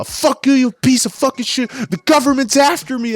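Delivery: very high-pitched mock shriek; freaked-out paranoid customer. Paranoid customer cursing out the clerk, hysterical. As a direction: imitate a frantic, squealing meltdown. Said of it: "She freaked out".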